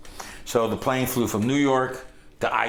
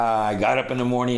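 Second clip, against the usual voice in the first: ordinary delivery, nothing marked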